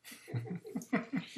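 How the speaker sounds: high breathy burst of laughter. Real, not acted. Laughing at the host's joke reaction. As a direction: laugh out at the absurd guy.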